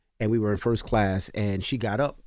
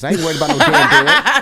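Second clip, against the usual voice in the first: loud, high comic defiance breaking into laughter; mock a feisty grandma, cracking up; imitated defiant grandma; 13 semitones higher, 10 dB louder than usual.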